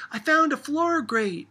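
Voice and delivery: put-on high, loud excited child's holler; loud excited girl's holler. A narrator's reading. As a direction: shout it out like a child with a find. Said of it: "she hollered".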